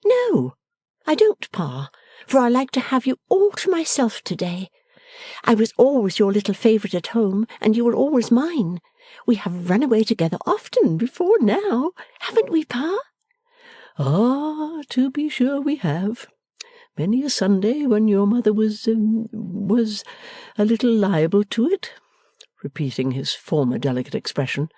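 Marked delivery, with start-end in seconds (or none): 0.0-13.0 lightly voiced young woman, higher; a lively daughter giving orders fondly; bright young daughter. A narrator's reading. Bella affectionate. 14.0-21.9 lightly voiced fond father, a bit higher; a gentle old father pleased with his daughter; father answering his daughter, lightly voiced and pleased. A narrator's reading. Father recalling.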